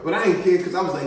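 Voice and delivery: normal talking voice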